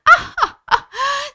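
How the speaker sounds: high, loud acted laughter; storybook mouse's "ah, ha, ha"; hearty pretend laugh. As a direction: laugh big for the children. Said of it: "having a good old laugh".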